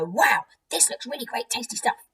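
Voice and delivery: high, eager little mouse voice; excited mice. Acted. Mice finding the poison, high excited voice. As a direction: squeak it with greedy delight. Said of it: "the mice find it and go".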